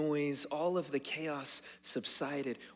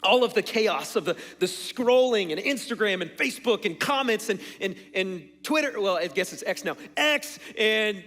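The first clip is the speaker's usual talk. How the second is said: loud, higher-pitched piling up of a list; forceful preaching; 6 semitones higher, 9 dB louder than usual. Real, not acted. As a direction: preach the noise and chaos with raised voice. Preaches over the chaos.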